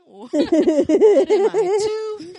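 high, loud laughing words; laughing hard. Real, not acted. Mocking her own excuse, cracking up loudly. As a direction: crack up mid-question.

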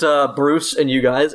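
normal talking voice

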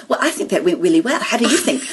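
"really" said as "weally"; imitated speech impediment. Friend mimicking the organiser over brandy, with impediment. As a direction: quote it with w for r, laughter creeping in. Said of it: "I mimic people".

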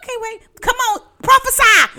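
high, loud mock-preacher outburst; playful prophesying. Host jokes that they will be on TV, prophesying loudly. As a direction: shout it like a hyped-up church exhortation. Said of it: "I was prophesying".

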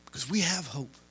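ordinary talk

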